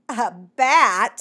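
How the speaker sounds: loud, incredulous echo; taken aback. A narrator's reading. Mockingbird hears that a bat might make poems, loudly incredulous. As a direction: blurt it out in disbelief.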